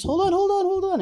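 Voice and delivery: very high, hurried hold on; flustered Balinese mom. Retold or imitated. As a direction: fuss like a frantic mother. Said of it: "she pulls out of her purse".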